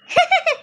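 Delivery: a short scoffing laugh; mocking laugh. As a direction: laugh off the silly boast. Scoffing at shopkeeper's claim.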